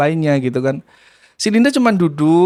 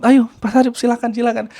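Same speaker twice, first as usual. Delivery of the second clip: eager welcome; 8 semitones higher than usual. Retold or imitated. Teachers welcoming him eagerly.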